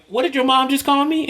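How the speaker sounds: can't believe what you heard; shocked disbelief. Retold or imitated. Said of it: "I, I, I was like".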